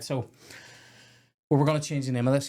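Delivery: ordinary delivery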